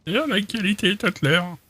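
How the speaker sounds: high, slow character voice; Tutler. Acted. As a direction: stretch it out in a silly high voice. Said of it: "Il fait la voix de Tutler". (voix de personnage aiguë et lente)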